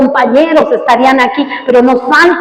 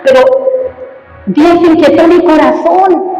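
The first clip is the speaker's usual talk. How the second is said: raised, driving pulpit voice; fiery preaching; 6 semitones higher than usual. Acted. Pastor urges his congregation to compassion, fiery preaching. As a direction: preach it hard and pushing.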